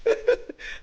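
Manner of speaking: short high-pitched laugh; a quick high burst of laughter; amused laugh. Real, not acted. Guest laughing after calling himself [name] the builder, amused.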